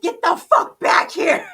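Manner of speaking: high, loud mock-outraged shout; retold angry yell. Retelling being left behind on the ship, angry yell. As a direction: yell after someone who ditched you.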